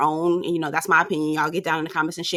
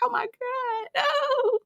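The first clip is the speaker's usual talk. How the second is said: much higher, wailing half-laugh cry; mock crying; 6 dB quieter than usual. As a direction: squeal it like tearing up at the TV. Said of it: "I was in there crying".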